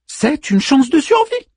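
high voice, rising at the end; Manmut's first answer. A narrator's reading. Manmut offers Orpheus a chance, high rising answer. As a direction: answer brightly pitched, lifting the final word.

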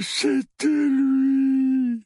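high, very drawn-out whimper; mumbling accuser. A narrator's reading. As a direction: moan it out feebly and slowly. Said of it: "marmonna Dudley". (marmonnement geignard)

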